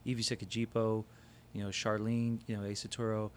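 usual speaking voice

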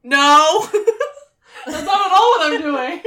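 loud laughing denial; laughing protest. Real, not acted. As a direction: protest loudly through laughter. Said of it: "Are you stalling so you can look at your bookshelf?".

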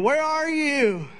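high, slow panicked cry into the phone; panicked scream. Retold or imitated. Wife on the phone panicking at husband, high panicked scream. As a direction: shriek it out like she's terrified. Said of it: "She's screaming".

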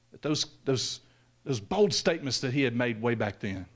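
ordinary talk

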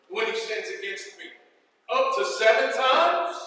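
dramatic Bible reading, building loud in the tense parts; scripture reading. Acted. Preacher reading the parable to his congregation, dramatic scripture reading. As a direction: read the parable to the church with conviction. Preacher reads Matthew 18 aloud.

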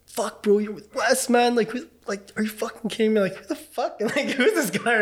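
a lightly voiced mate shocked over Wes; excited friends. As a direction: act out the friends freaking out.

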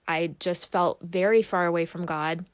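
ordinary talk

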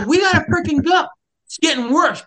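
shouting at a stubborn hiker to leave; urgent shout. Retold or imitated. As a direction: yell it over the storm. Yelling at hiker in storm.

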